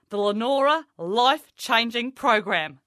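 ordinary delivery

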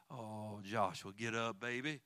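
tender coaxing. Retold or imitated. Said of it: "I don't think it was like".

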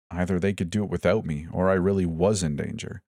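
ordinary delivery

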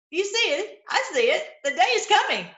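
excited proclaiming. Real, not acted. Preacher proclaims coming day.

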